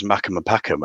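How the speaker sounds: Geordie "Mackem and Packham", short "a" in both words; Geordie accent. Shown as a demonstration. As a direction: say "Mackem and Packham" Geordie-style, MACK rhyming with "back". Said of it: "in the Geordie accent".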